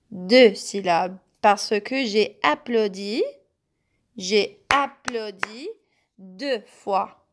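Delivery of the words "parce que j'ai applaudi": usual speaking voice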